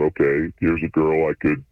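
usual speaking voice

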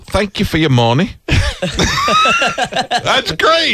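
high, put-on English lady voice; Heather Mills impression. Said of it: "That was my Heather Mills".

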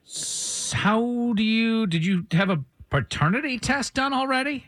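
high-pitched, halting disbelief; taken aback. Real, not acted. Radio host questioning fiance who denies paternity, taken aback.

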